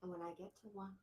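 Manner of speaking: normal talking voice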